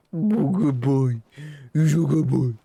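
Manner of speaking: dog praise voice. Real, not acted. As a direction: coo praise at a good dog.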